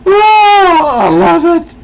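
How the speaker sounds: high, loud delighted squeal of laughter; shrieking with joy over the page. Real, not acted. Delighted by the comic.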